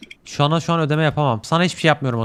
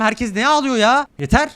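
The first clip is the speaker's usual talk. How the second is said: high, slow fed-up cry; exasperated outburst; 7 semitones higher than usual. Real, not acted. Fed up with the crying villagers, high pitched outburst. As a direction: snap at everyone to stop.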